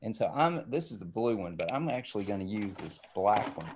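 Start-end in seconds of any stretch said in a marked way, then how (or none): none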